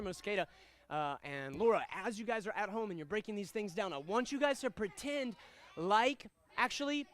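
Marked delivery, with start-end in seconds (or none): none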